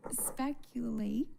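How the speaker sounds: "Speculate" said slowly and carefully as spek-yuh-late through a laugh; correct pronunciation. Real, not acted. Vlogger fixing her mispronounced word to viewers, slow and careful. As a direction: spell out "Speculate" slowly, getting it right at last. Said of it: "I cannot believe I just did that".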